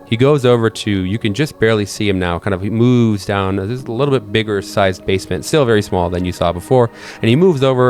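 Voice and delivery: ordinary talk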